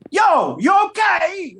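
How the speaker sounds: loud, high, drawn-out New York holler. Retold or imitated. Actor overdoing his retake, hammed-up New York accent. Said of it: "every New York stereotype I could come up with".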